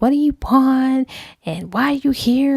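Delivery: mock the woman snapping at her ex; annoyed demand. Woman confronting her ex at the door, lightly annoyed. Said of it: "she was like".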